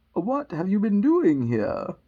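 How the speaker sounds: loud, slow interrogation; imperious Queen. Acted. Queen questioning the gardeners, loud and slow. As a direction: demand it loudly, drawing the words out. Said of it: "she went on".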